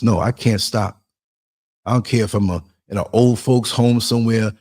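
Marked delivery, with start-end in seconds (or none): none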